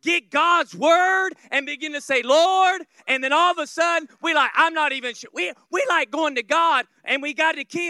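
loud, climbing sermon delivery, pushing harder; fiery preaching. Acted. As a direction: preach it to the back pews. Preaching builds to full force.

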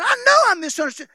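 a touch of embarrassed concession; mock sheepish admission. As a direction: give in with a loud laugh-edged yelp. [name] conceding.

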